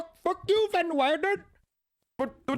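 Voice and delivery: high-pitched imitation Indian accent. Streamer imitating an actor's movie role, mock Indian accent. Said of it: "He had an Indian accent".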